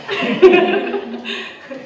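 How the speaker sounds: a loud, high, delighted laugh; burst of laughter. Real, not acted. Teacher laughs out loud at being asked about her students.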